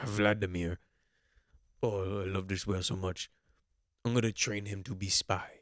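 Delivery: hammed-up, even-pitched mock Russian accent; Vladimir Putin's Russian accent. Young leader naming his beloved pet whale, mock Russian accent. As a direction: labour through a badly faked Russian accent, stumbling on the name. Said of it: "I can't do it. Just bear with me".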